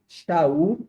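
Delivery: Shaul with a SH onset, rising at the end; Hebrew form. Shown as a demonstration. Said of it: "você tem a forma hebraica". (forma hebraica "Shaul", com som de X no início)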